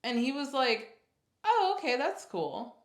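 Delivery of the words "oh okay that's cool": light, high-pitched "oh", then easing down; totally chill. Retold or imitated. Imitating boyfriend hearing she might be pregnant, totally chill. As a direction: imitate someone breezily unbothered. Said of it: "totally chill about it".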